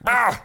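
sudden high yelp of pain; startled yelp. Pretend. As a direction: yelp as if the ear caught on a hanger. Imagined ear caught on something.

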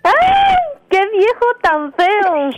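loud, slow disgusted cry; disgusted shout. Real, not acted. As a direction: shriek at how ugly he is. Woman exclaims in disgust.

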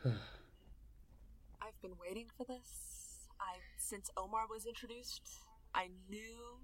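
very soft, savouring it; quiet, heartfelt fan confession; hushed contentment. Real, not acted. Delighted player savours the long-awaited romance.

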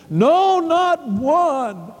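high, ringing declaration; emphatic refrain. Real, not acted. Preacher declaring no one else could heal, high and emphatic.

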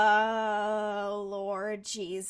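long sigh, then a slow weary "Lord Jesus"; exasperated sigh. Real, not acted. Podcaster groaning at the episode, slow and weary.

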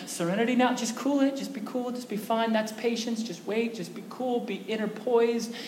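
self-soothing. Acted. Talking to yourself to cool down, hushed self-calming. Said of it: "to cool yourself down".

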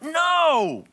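high, loud exclamation; sharp loud no, pitch high; emphatic no. Real, not acted. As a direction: shout it down. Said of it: "This is mad".

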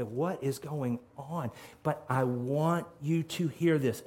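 normal talking voice

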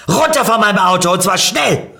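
fast, loud, high-pitched order; angry shouting. A narrator's reading. Car owner orders narrator off his car, angry shout. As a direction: yell at them to get off, outraged. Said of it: "schrie mich der Besitzer des Autos an".

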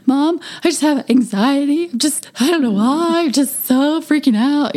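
mock-tearful kid pleading with mom; imitating crying kid. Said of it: "He's crying".

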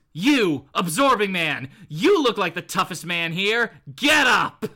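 punchy challenge rising to a shout; commanding Titania. Acted. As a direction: order him up, loud on the last words. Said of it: "walks over to, uh, the Absorbing Man and goes".

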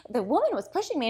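high-voiced mock complaint; comic storytelling grandma. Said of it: "amazing storyteller and a hilarious comedian".

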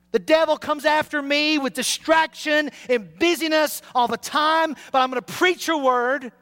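fervent prayer. Acted. Pastor pleading with God for his church, fervent prayer. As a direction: pray hard, pleading with God.

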